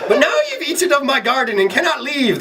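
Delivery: high, hammed-up Irish leprechaun; high-pitched gleeful Irish little man; Irish accent. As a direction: gloat in a comic Irish brogue. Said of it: "the Irishman replied".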